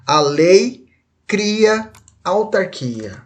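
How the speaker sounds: very slow, pause between A LEI and CRIA; drawn-out teacher's dictation; slow and separated. Shown as a demonstration. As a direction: spell out "A lei... cria... autarquia" word by word. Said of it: "Se você falar isso aqui rápido".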